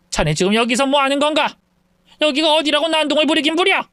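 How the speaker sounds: put-on an angry mother-in-law snapping at a rowdy drunk; scolding mother-in-law. (화난 장모의 꾸짖음)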